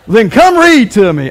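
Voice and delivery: lively teasing dare; playful challenge. Real, not acted. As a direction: throw it back at him with a grin. Joking reply to Hebrew graduate.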